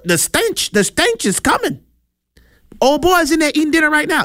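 high-pitched, hammed-up excited townsman; excited onlooker. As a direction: play an amazed onlooker spreading news. Acting out onlookers eager to see Lazarus.

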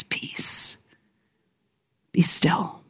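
soft, hushed whisper. Acted. God climbing into your boat to calm the storm, whispering. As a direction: whisper it slowly with long pauses, calming. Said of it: "he whispers".

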